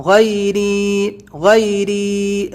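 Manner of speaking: غیری with the ری drawn out long; stretch the ri. Shown as a demonstration. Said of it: "ری کو کھینچنا ہے". (غیری میں ری کو کھینچ کر)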